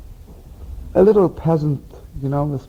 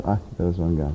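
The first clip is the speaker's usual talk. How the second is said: devout murmur; 8 semitones lower, 6 dB quieter than usual. Retold or imitated. Rebbe reminding himself of God, low soft sigh. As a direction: breathe it out like a quiet reminder to himself. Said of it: "he would mamish go like this".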